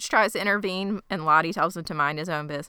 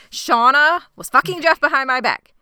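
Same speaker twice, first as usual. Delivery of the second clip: loud, higher retold exposé; acted angry announcement; 8 semitones higher, 7 dB louder than usual. Jackie exposes Shauna to the group, acted angry announcement. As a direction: announce the betrayal to everyone, loudly.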